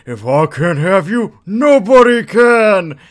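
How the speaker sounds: slow melodramatic cry building to a high shout; desperate shout. Acted. Husband pulling a knife on his wife, desperate drawn-out shout. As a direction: ham it up, stretching the threat into a yell. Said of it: "in desperation, he pulls out a knife".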